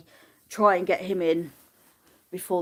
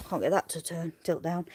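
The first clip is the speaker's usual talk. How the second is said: normal talking voice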